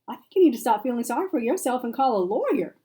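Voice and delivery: loud, high tough-love push; firm and loud. Acted. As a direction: snap her out of it.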